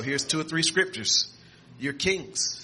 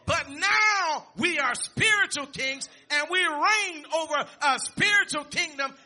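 higher, louder preaching with a ringing call; raised-voice pulpit preaching; full-force preaching; 11 semitones higher, 3 dB louder than usual. Acted. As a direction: build the congregation up to a proclamation.

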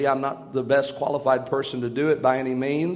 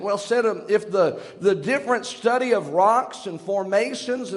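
higher, rising pulpit voice, building; fervent preaching; 8 semitones higher than usual. Acted. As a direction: preach it out to the congregation. Preacher builds his sermon.

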